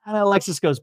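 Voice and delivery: ordinary talk, nothing marked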